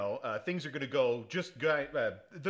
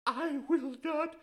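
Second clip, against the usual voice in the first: much higher, slow falsetto defiance; high quavering lady standing firm, getting louder; mock defiant lady; 4 dB louder, slower than usual. Owner refuses the Yakuza thugs in a high slow falsetto. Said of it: "And she's like".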